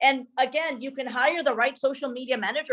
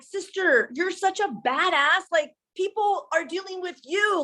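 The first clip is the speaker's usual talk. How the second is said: higher, slower cheering for her sister; hyped-up pep talk; 6 semitones higher, slower than usual. Retold or imitated. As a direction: pump her up like a big fan. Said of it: "I'm like".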